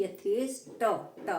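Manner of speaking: usual speaking voice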